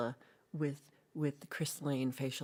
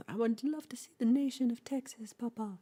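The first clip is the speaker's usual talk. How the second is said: put-on high, whispery little-boy voice; little boy pleading; 8 semitones higher, 4 dB louder than usual. Pepe begging his papa in a high little whisper. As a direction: breathe it high and sweet like a small child.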